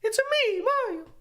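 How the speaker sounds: very high cartoon Mario impression; high-pitched Italian plumber voice. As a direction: squeak it out like Mario. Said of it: "Is that too high?".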